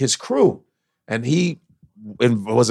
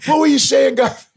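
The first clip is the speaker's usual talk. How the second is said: much higher, meek mock apology; sheepish mob guys; 12 semitones higher than usual. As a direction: play the tough guys suddenly timid.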